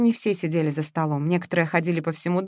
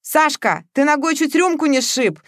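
high, loud exclamation; exclaiming; 7 semitones higher, 5 dB louder than usual. A narrator's reading. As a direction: shout a warning. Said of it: "воскликнул Матвеев". (восклицает, высоко и громко)

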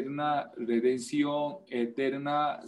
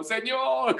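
hammed-up shouted plea, much higher; mock wailing cry; 10 semitones higher than usual. Preacher imitating religious people shouting nightly, mock wail. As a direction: cry out to God in mock anguish. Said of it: "gritando cada noche".

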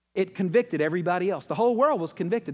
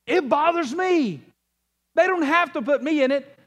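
much higher, louder, slow pleading to God; passionate prayer; 9 semitones higher, 5 dB louder, slower than usual. Acted. David crying out to God, loud, slow, impassioned. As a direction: pour it out like a desperate love plea. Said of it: "He says".

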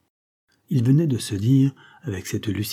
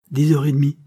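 loud announcement; 5 semitones higher, 7 dB louder than usual. Acted. Captain announces the time.

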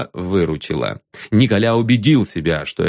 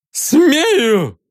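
put-on high falsetto drunk yell; drunken falsetto shout; 14 semitones higher, 3 dB louder than usual. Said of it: "крикнул он пьяным фальцетом".

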